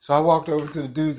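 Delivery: usual speaking voice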